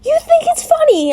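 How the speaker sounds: very high, loud indignant question; angry yell. A narrator's reading. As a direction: yell it in outrage. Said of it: "I yelled".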